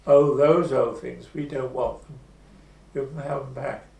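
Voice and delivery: a loud, breezy brush-off that tails off; loud dismissive villager. Retold or imitated. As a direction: wave the old things away. Villager dismissing old paintings.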